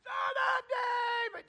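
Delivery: very high, drawn-out scream; high desperate acted cry; held-back shriek. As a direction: shriek it slowly without full volume. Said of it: "the Greek tense is he shrieked".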